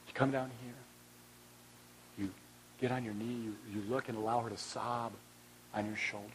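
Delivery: kneel down and speak softly to her; hushed and gentle. Acted. Preacher showing how to comfort a widow, very soft.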